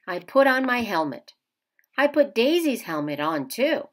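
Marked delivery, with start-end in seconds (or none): none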